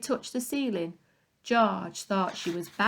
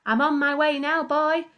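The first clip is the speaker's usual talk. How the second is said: higher, louder call from above; 7 semitones higher, 5 dB louder than usual. A narrator's reading. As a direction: call down to the boy below. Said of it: "she called down to George".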